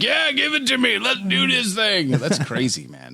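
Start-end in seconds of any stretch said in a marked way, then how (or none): 0.0-2.1 cocky bravado. Retold or imitated. Mimics friend's bravado.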